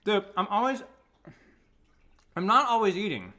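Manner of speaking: talking through a mouthful; chewing between words; mouth full. Real, not acted. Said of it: "talking with my mouth full".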